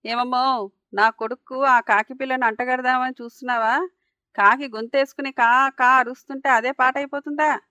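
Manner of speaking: loud, indignant neighbour spoiling for a quarrel; narrated quarrelsome outburst; picking a fight. A narrator's reading. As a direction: confront her, raising your voice as you go. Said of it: "అని పోట్లాటకు వచ్చింది ఆవిడ".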